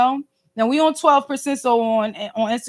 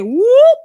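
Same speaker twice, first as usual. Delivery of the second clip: mock whoop; 10 semitones higher, 7 dB louder than usual. Brother's scar appears on her.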